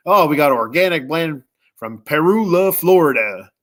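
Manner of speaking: light hillbilly twang, La Florida flattened to luh FLOR-uh-duh; folksy mock hillbilly reading of Peru, La Florida. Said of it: "like a hillbilly, it gets".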